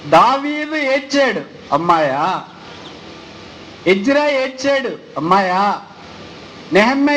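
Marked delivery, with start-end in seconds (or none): 1.7-2.4 mocking retort. 5.2-5.8 mocking retort.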